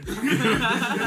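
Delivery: high, loud laughing burst. Real, not acted. Laughing while retelling the sister's call. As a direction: break into laughter.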